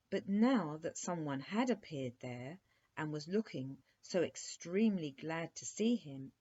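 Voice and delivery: usual speaking voice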